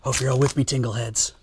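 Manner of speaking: soft breathy ASMR whisper. Pretend. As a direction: lean in and whisper close to the mic. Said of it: "Loading Ready Run A S M R".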